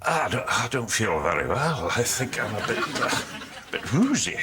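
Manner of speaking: woozy put-on Scottish accent. Shown as a demonstration. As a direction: demonstrate a dazed Scot, hesitant and halting. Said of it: "give us a little bit of this voice".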